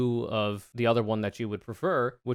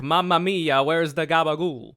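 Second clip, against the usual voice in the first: hammed-up Italian accent, raised and loud; mock Italian impression, higher pitched; DJ Akademiks as an Italian; 4 semitones higher, 5 dB louder than usual. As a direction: exclaim it like a cartoon Italian. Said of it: "Italian D J Akademiks be like".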